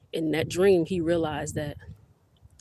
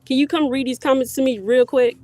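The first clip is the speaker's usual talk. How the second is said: loud, high-pitched request at a distance; calling out; 7 semitones higher, 10 dB louder than usual. Real, not acted. Mother asks daughter to read comments, calling out. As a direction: holler the question across the yard.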